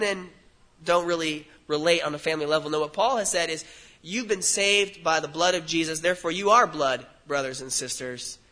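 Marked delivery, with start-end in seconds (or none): none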